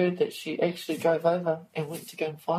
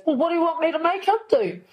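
high, loud imitation of an exasperated sister-in-law; 9 semitones higher, 4 dB louder, faster than usual. [name] mimics sister-in-law on the phone, exasperated and raised. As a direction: toss it back, helpless and put out.

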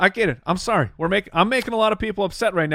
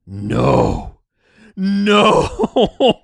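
dread; 6 dB louder than usual. Retold or imitated. Dreading the elf season.

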